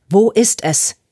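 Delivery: loud, forceful demand; angry bellow. A narrator's reading. Lucas demanding the phone from his daughter, angry bellow. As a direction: demand it loudly, furious. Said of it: "brüllte Lucas sie an".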